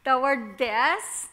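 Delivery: ordinary delivery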